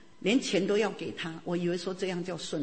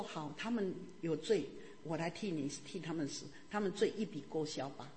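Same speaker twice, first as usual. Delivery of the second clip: much softer and gentle, like a quiet promise; tender voice of Jesus; 3 semitones lower, 9 dB quieter than usual. Acted. Jesus tenderly offering to die for sinners, soft. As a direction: offer it softly, as a gift.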